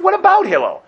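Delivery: high, loud, outraged protest; a man at heaven's gate arguing back in disbelief; indignant retort. Pretend. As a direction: throw the question back, exasperated and offended. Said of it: "And I said".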